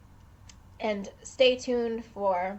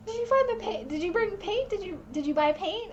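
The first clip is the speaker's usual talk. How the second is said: eager questioning; 8 semitones higher than usual. Retold or imitated. Friends eagerly asking about paint.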